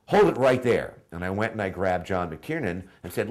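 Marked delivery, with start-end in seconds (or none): 0.1-0.9 louder, higher, sudden call to halt; excited interjection. Retold or imitated. Writer stopping an actor after hearing his accent, excited interjection. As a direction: cut in urgently, punching it out.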